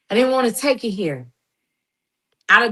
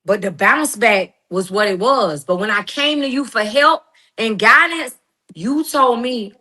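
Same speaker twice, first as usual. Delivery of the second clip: ordinary talk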